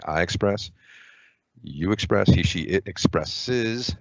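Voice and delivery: slow conjugation drill, hitting "expresses"; walk through the verb forms slowly, stressing "expresses". Shown as a demonstration. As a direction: say "I express, you express, he, she, it expresses" slowly, leaning on the ending. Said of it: "the way to do this is say".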